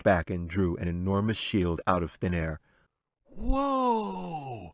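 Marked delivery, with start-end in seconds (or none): 3.3-4.7 put-on high drawn-out boy whoa; amazed whoa. A narrator's reading. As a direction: breathe out in awe. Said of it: "was all Jack could say".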